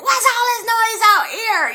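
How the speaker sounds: high, loud mock-angry demand; imitated irate neighbor. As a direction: holler it like a neighbor woken by noise. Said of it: "the guy comes, he opens his door, and he's like".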